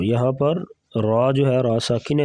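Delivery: normal talking voice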